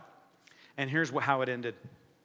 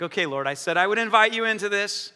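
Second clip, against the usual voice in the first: resigned compliance; 7 semitones higher, 10 dB louder than usual. Retold or imitated. Preacher retells reluctantly answering the Lord, loud strained compliance. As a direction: protest loudly up to heaven. Reluctant reply acted out to God.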